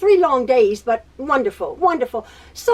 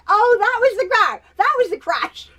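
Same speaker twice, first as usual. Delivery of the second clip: mock dismissive voice; 8 semitones higher than usual. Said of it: "But that was like".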